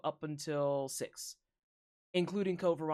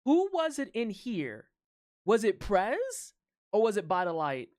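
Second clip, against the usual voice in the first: dramatic questioning; 4 semitones higher, 6 dB louder, slower than usual. Pretend.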